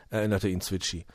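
ordinary delivery

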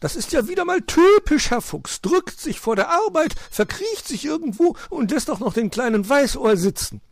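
very high, loud squawking rant; agitated storybook bird; indignant magpie. A narrator's reading. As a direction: scold in high-pitched outrage. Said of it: "empörte sich die Elster".

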